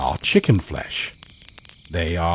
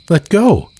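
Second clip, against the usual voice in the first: put-on high, small child's voice, a little louder; young boy's voice; 11 semitones higher, 6 dB louder than usual. A narrator's reading. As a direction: voice the drowned little brother, pitched high. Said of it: "Brett says".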